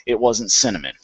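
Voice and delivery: ordinary talk, nothing marked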